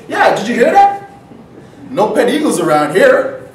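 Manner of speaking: high, loud bird calling back; jeering seagull. Acted. Second seagull answers the flock's leader, loud high mockery. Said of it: "the other bird looked around like".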